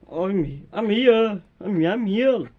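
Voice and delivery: loud announcing "I'm here"; pretend police call out. Police officer announces arrival at the door, loudly. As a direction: boom out your arrival.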